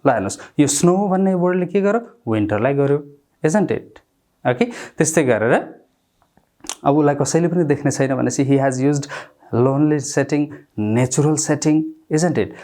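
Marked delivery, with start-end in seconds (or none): none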